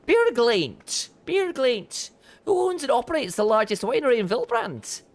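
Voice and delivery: lightly voiced NPC, measured and knowing; retired mercenary vintner. Vintner explaining the problem to the adventurer, lightly voiced.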